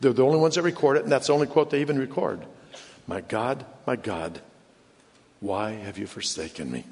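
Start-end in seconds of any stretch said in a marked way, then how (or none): 3.1-6.9 soft, low, broken up by pauses; low, solemn, spaced-out plea; hushed lament. Retold or imitated. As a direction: quote the forsaken cry gravely and quietly. Same cry repeated slowly, hushed.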